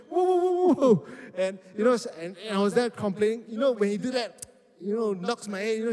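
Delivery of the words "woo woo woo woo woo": very high, loud playful woo-woo hoots; imitating a friend's hooting. Imitating Clarence rubbing people's heads, high playful hooting. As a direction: hoot like an excited big brother rubbing your head. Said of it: "he would always do that to me".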